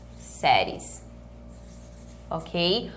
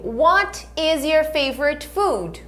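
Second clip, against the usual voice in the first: slow, clear teacher model; "What is your favorite food?" said slowly word by word; slow repeat-after-me; 4 semitones higher, slower than usual. Shown as a demonstration. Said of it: "Repete comigo".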